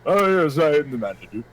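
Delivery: a light put-on posh accent as a fake manager; hammed-up fake manager with a mild accent. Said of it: "pretend to have an accent".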